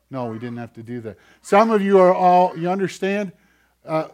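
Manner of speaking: normal talking voice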